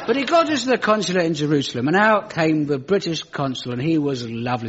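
usual speaking voice

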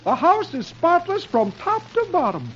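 high, proud comic husband; Dagwood. Acted. Dagwood greeting wife with spotless house, high proud voice. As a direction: show it off excitedly, pitch way up.

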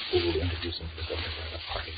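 ordinary delivery

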